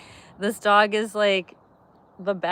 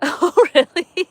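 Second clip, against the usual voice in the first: usual speaking voice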